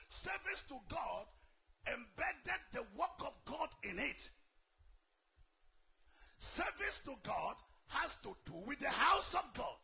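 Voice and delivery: loud preacher's build-up, each line hammered; booming sermon cadence; fiery preaching. Acted. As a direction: drive the point home from the pulpit. Preaching at full force.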